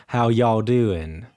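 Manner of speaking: slow, smooth deep South drawl; smooth deep South accent. Shown as a demonstration. Showing the smoother deep South sound, slow drawl. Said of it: "a little smoother in the sense of".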